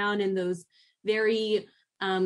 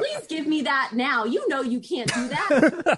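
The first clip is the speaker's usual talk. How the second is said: 1 mock firm parent; 5 semitones higher, 5 dB louder than usual. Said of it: "you know what I was thinking".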